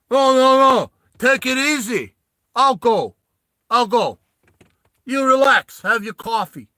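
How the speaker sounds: loud, high New Yorker voice; brash New York bark, no no no; New Yorker accent. Acted. GM voicing Stallone telling the big guys to relax, New Yorker accent. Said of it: "Now he's sounding like a New Yorker".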